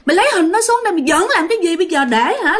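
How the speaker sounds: fast, high-pitched exasperated berating; retold angry scolding. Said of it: "bắt đầu chửi cái ông kia". (giả giọng chửi trách dồn dập)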